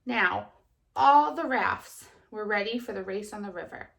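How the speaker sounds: put-on lively storybook reading to children; kindergarten teacher voice. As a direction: read it brightly to little kids. Teacher reads story aloud.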